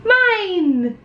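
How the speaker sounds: high, whiny mine; possessive whining. Acted. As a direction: whine it like a greedy kid. Greedy character again.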